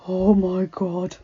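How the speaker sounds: slow, stunned breath. Acted. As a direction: breathe it in horror. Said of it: "he breathed".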